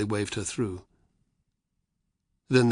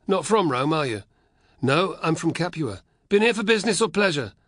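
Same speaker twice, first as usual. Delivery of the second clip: higher, louder soldier with a wink; teasing guard; 8 semitones higher, 6 dB louder than usual. Acted. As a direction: needle the boy from Capua. Soldier interrogating voice.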